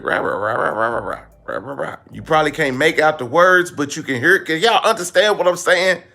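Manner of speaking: usual speaking voice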